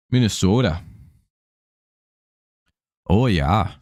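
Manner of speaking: hammed-up Minnesota accent; rounded long-O Minnesota accent. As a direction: say it like a caricature Minnesotan. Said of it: "my Minnesota, uh, impersonation".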